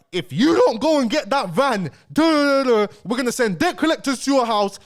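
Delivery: mock the manager's loud threats; threatening manager. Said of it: "the guy's threatening".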